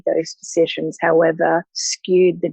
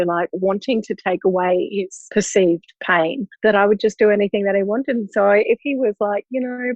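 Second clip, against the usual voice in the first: normal talking voice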